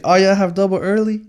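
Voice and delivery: high mock cop voice. Campus cop asking the seniors, high mock voice. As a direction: pitch it up like the officer checking. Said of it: "She's like".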